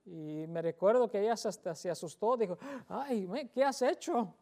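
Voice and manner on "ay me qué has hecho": high, startled mock cry of alarm; alarmed, higher exclamation; startled gasp. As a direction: imitate her shocked reaction. Startled wife reacts in story.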